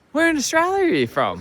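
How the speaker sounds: high, eager imitation of a friendly local; playful high-pitched imitation of a curious local. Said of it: "and they'll be like".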